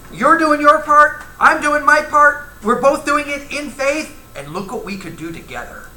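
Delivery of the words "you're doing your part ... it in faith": higher, louder and slow, each clause hammered; emphatic. Acted. Preacher to congregation on faithful couples, higher, louder, slow and emphatic. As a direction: preach it out with rising fervor.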